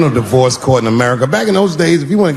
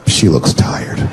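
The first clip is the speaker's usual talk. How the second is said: whispered, lower tender thought; 9 semitones lower, much slower than usual. Retold or imitated. Husband thinking tenderly.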